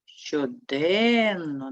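"Щоденно" with the middle syllable stretched out; stress on second syllable. Shown as a demonstration. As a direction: lean long on "ден" in "Щоденно". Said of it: "Поставимо наголос".

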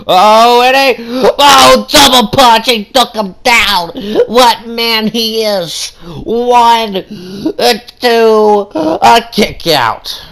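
excited play-by-play. Acted. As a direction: hype the count like an announcer. Calls the pin count.

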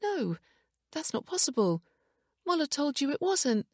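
high-pitched distressed denial; disbelieving. A narrator's reading. Wife rejecting the DNA results, distressed. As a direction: refuse to believe it, pitch well up.

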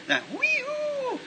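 high, slow whooping bugle call; elk call. Retold or imitated. Hearing the elk far off.

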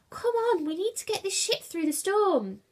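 high-pitched, urgent holler; rally the crew onward; urgent rallying call. A narrator's reading. Julius rallies crew through the storm, urgent call.

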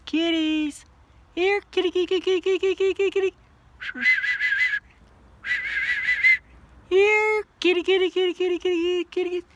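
high loud sing-song kitty call; calling a lost pet. Real, not acted. Calling kittens hiding in box.

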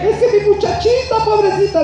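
high, loud mock mother's whine; doting mother. Preacher mocking a mother pitying her son, high whine. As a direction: ham up the mom pitying her boy.

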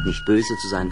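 ordinary delivery, nothing marked

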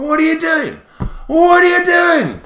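high, slow, drawn-out cry of disbelief; exasperated mock shout. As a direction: wail at the silly spelling. Mocking the odd spelling of buy.

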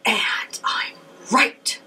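hushed insistence. Retold or imitated. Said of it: "and I'm like".

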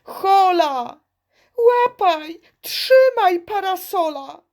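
high, loud desperate cries; children shouting. Acted. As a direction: shout after the flying umbrella. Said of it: "Darmo dzieci krzyczą". (głośny, wysoki krzyk dzieci)

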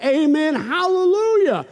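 high, drawn-out worship shout; cried-out praise. Acted. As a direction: cry it out slowly in triumph. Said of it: "And they cried".

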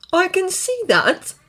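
high, slow dry remark; very wry. A narrator's reading. Newly woken dragon to his delighted brother, wry. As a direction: draw it out wryly, up high. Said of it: "very wry".

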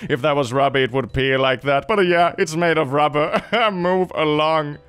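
put-on voice with dismissive chuckles; sarcastic skeptic, chuckling between jabs; scoffing know-it-all. Doubter dismissing a rubber tree video, smug scoffing.

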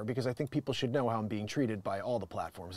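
ordinary talk

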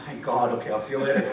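a soldier glad to get out; relieved deserter. Retold or imitated. Preacher voicing a relieved soldier heading home, low and laughing.

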